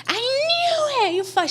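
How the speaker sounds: high, drawn-out mock wail; imitated tearful accusation. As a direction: wail it like mom crying and accusing. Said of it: "She's crying".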